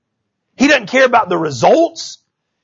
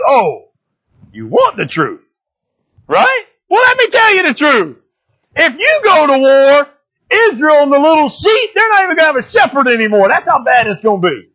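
smug, pitched up much higher, teasing the king; smirky; 8 semitones higher than usual. Retold or imitated. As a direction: smirk through it, mocking him with the truth. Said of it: "Micaiah does get a little smirky".

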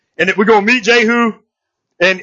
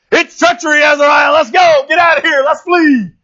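higher-pitched mock shout of alarm; retold panicked shout; 5 semitones higher than usual. Fleeing king shouts to his boy in the other chariot, mock shouting. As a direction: call out a warning, acting it out. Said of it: "he's shouting".

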